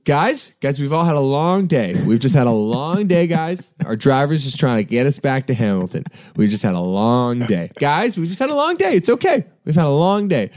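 rising, pleading reassurance; soothing the crowd. Retold or imitated. Singer calming the angry passengers, pleading and soothing. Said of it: "And then I was like".

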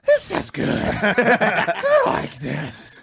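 put-on high breathy girlish voice, slowed right down; girlfriend impression. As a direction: play her, sighing with pleasure. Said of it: "I'll do an impression of her".

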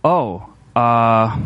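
imitating someone at a loss, drawn-out uh; mock uneasy stalling; an awkward "oh", then a stuck "uh"; awkward hesitation. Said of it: "it's like the elephant in the room".

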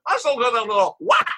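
put-on high, loud Japanese-accented chef puzzling over the punchline; hammed-up chef repeating the joke; broken English accent. Chef repeats the joke to his coworker, high Japanese accent. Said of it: "clearly asks him in Japanese".

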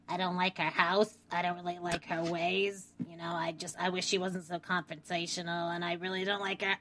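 soft, hushed imitation of Nancy; quiet mock Nancy. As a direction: murmur it like a confession. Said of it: "She's like".

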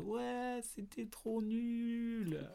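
high, slow, soft whiny voice; mock viewer comment. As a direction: drag it out like a bored viewer. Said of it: "à dire".

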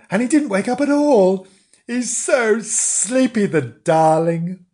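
put-on high, slowing, cooing child voice; doting. Imogen doting on sleepy Bernard, cooing.

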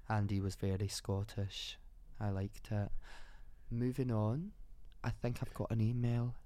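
soft-spoken Scottish accent. Shown as a demonstration. Co-host asked for their best Scottish, Scottish accent. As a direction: put on your best Scottish, fairly quiet. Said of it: "Do your best Scottish".

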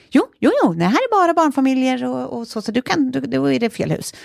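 acted-out stubborn correction; insistent. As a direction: contradict him firmly, starting high and settling. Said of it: "bestämd".

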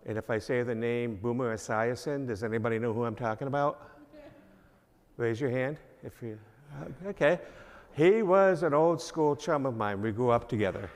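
ordinary delivery

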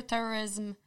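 ordinary talk

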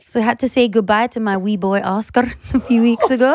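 put-on light Scottish accent, half laughing. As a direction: read it in a Scottish lilt. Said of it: "you're doing it in a Scottish accent".

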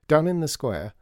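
plain reading voice

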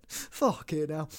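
ordinary delivery

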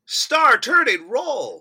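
loud, high, slow proclamation; hammed-up excitement. Co-host converted on the star-turning role, in loud excitement. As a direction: belt it out, stretching each word. Flips from doubt to enthusiasm.

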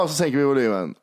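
ordinary talk, nothing marked

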